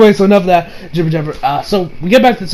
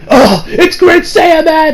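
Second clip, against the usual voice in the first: higher, slow astonished cry; awed crowd; 9 semitones higher, 3 dB louder, much slower than usual. Retold or imitated. Onlookers spotting the hero, slow awed cry. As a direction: gasp out the hero's name.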